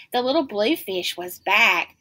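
ordinary delivery, nothing marked

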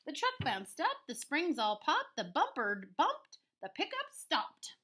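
lively, swinging storybook narration; story read-aloud. A narrator's reading. Teacher reading a rhyming farm story to pupils, lively read-aloud. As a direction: bounce through the rhyme for young listeners. Reads picture book to children.

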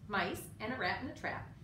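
ordinary delivery, nothing marked

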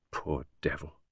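very soft, low mutter; muttered under breath. A narrator's reading. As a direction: murmur it to yourself, barely voiced. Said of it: "muttered under her breath".

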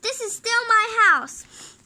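loud, slow furious shout; angry shout. Acted. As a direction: bellow it at her. Father shouts back.

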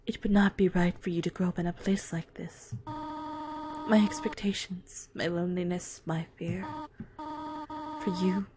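Goat Mom farewell. Acted.

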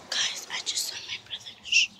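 breathless secret whisper; urgent whisper. Real, not acted. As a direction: whisper so brother won't hear. Hiding from brother, says shh.

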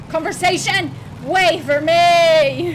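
loud, eager call to the game; excited shout. Real, not acted. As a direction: yell out, don't miss the scene. Streamer rushing to catch cutscene.

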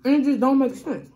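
talking while chewing nachos. Real, not acted. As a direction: mouth partly full of food. Seen 4: Picks nachos from the box; lips pressed, chewing between bites throughout.

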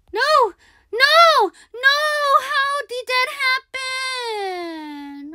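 slow, high, whining wail; high slow despairing whine; wailing disbelief. Real, not acted. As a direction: drag it out in despair. Disbelief after dying.

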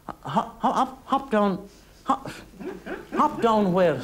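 high, stammering disbelief; startled stammer. Acted. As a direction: stumble over the words in shock.

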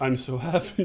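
low, drained imitation of happiness; deadpan. Showing friend how the win was announced, deadpan. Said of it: "Like, just deadpan".

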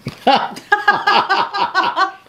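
high burst of delighted laughter; a long, high real laugh that bursts out. Real, not acted. Husband laughing at his wife's prepared DNA notes, high delighted laugh.

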